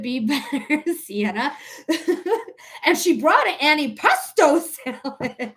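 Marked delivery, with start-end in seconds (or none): 2.9-5.5 higher, swooping mock-dramatic delivery; Mister Pearl Mania impression. Streamer redoing the catchphrase for fun, hammed-up impression. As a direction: ham it up, breaking into laughter. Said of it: "Just like Mister Pearl Mania".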